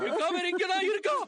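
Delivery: frantic high denial; desperate denial. Acted. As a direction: insist she is here, breaking down. Denial of her death.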